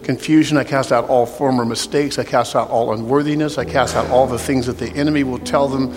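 soft, low prayer voice over music; altar call prayer. Real, not acted. Pastor leading an altar call prayer, soft and low. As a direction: pray gently over the bowed room.

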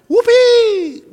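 high, loud sarcastic cheer; mock whoopee. Preacher mocking a cheer over a surprise bill. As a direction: cheer like it's good news, ironically. Mock joy at owing money.

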